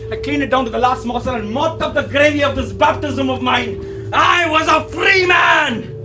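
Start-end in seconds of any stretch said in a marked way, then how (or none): 4.1-6.0 high, drawn-out cry of liberation; triumphant shout. Acted. Son exulting after tasting forbidden pork, triumphant shout. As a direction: proclaim it loudly, stretching the words.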